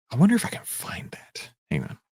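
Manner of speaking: whispered, half to herself; whisper to self. Real, not acted. Host searching her charts, whispered aside. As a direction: murmur while hunting for a chart.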